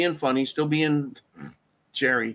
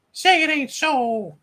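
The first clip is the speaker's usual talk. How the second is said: mock voice; 10 semitones higher than usual. Mocking put-on voice.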